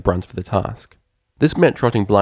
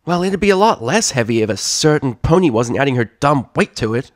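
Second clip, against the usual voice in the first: grunted complaint; 6 semitones higher, 5 dB louder than usual. Acted. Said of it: "Scootaloo grunted".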